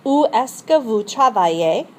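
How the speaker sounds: Où est-ce que vous travaillez? said clearly, rising on que; model question. Shown as a demonstration. Teacher modelling a question for repetition. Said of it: "you're gonna repeat these after me".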